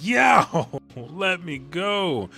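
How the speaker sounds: loud, high protest with a shriek; defiant pained yelling. Retold or imitated. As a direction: yell back, then drag out the last plea. Luffy struggles in painful grip.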